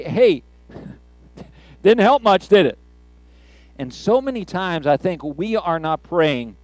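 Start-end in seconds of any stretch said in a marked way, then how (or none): 0.0-2.8 mock sarcasm. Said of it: "Wouldn't it have been easy for them to say".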